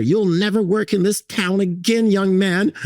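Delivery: high-pitched, imitated scolding; hammed-up angry boss laying down a threat; mock indignant threat. Designer threatening the tiler after the collapse, mock indignant.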